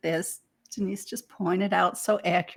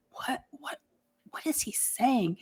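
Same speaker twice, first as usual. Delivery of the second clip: confused unvoiced whisper; 3 semitones higher, 8 dB quieter than usual. Listener lost in an Irish accent, confused whisper. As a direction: whisper it, baffled.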